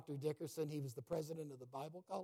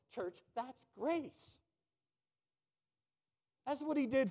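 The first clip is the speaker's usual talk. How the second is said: high, slow, insistent preaching; fervent preaching; 4 semitones higher, 5 dB louder, slower than usual. Acted. Preacher urging the church about grace, fervent and slow. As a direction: drive the point home to the church.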